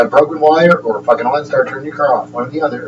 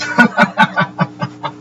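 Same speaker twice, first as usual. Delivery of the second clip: unvoiced giggle, pitched up; high breathy laugh; 6 semitones higher, 3 dB quieter than usual. Real, not acted. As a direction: laugh at your own joke. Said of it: "I'm laughing".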